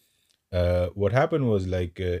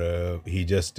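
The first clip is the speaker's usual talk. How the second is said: ordinary delivery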